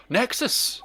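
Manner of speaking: sudden high delighted cry; excited gasp. Real, not acted. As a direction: gasp and shout it out.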